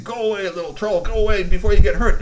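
high, loud warning; threatening troll. Acted. Big troll warns Globilda away, high and loud.

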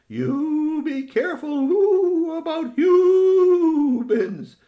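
very high, slow, loud hoots; hooting owl father. Acted. Owl father warns his child about humans, slow high hooting. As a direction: stretch the vowels into long owl hoots.

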